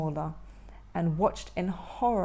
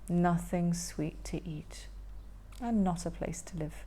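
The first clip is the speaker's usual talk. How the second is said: whispered lament; 5 semitones lower, 8 dB quieter than usual. Acted. Hungry troll repeating the line, whispered lament. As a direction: whisper it sadly. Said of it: "repeated whispered".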